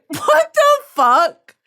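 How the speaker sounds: high, slow, drawn-out what the fuck; outraged disbelief. Real, not acted. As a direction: stretch it out in shocked disbelief.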